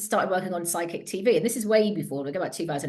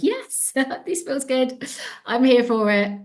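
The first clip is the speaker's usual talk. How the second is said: bright, much higher, giddy delight with a laugh; excited; 7 semitones higher, 3 dB louder, slower than usual. Retold or imitated. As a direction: gush like you just got the dream job. Thrilled at flagship show offer.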